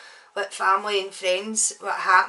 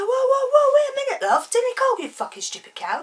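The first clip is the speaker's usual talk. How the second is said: very high, loud mock protest; protesting man; 6 dB louder than usual. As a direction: imitate a bloke backing off in alarm. Acted man protesting in quarrel.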